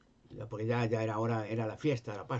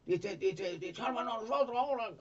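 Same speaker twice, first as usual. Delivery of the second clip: high, pleading cries; thieves crying out; 10 semitones higher than usual. Retold or imitated. Crucified thief shouting to Jesus, high and pleading. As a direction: beg to be saved, voice way up.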